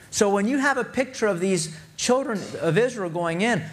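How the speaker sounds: preaching. Acted. Pastor pressing the point to his congregation, impassioned preaching. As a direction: lift the sermon to full fervour.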